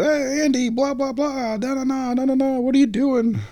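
very high mock greeting; retold excited reunion. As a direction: gush in imitation of a happy reunion. Retold old-home-week reunion greeting.

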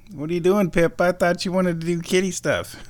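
high pet talk. Real, not acted. Talking to the cat.

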